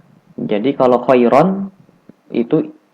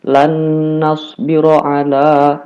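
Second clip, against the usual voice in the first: recited; 4 semitones higher, 3 dB louder, slower than usual. Shown as a demonstration. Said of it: "Contoh ada nun mati setelahnya nun".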